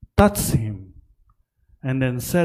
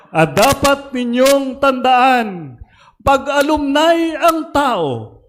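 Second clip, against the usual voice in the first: high, loud pulpit exhortation; full-force preaching; 11 semitones higher, 6 dB louder than usual. Real, not acted. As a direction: proclaim it to the congregation, pitched high.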